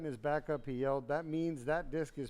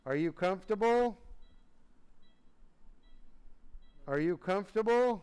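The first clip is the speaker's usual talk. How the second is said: higher and louder, calling to a drowsy patient; loud bedside call; loud check-in; 6 semitones higher, 4 dB louder than usual. Real, not acted. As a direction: raise your voice so he hears.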